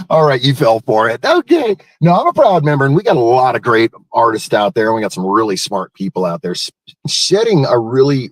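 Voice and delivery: ordinary talk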